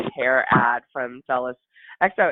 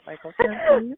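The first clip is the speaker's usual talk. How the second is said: gushing; 6 dB louder than usual. Real, not acted. Gushing over player photo.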